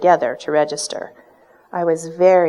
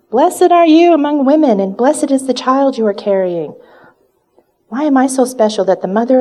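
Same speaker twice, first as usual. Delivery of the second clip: much higher and louder, a joyful cry of blessing; 7 semitones higher, 5 dB louder than usual. Acted. Elizabeth greets Mary at the door, joyful loud cry. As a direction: cry it out, filled with the Spirit. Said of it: "she said in a very loud voice".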